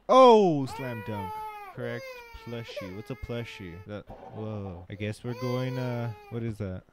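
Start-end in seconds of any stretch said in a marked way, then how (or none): 0.1-0.7 sudden very loud high oh; loud high startled exclamation; startled yelp. Real, not acted. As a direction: jump at a scare. Startled in game.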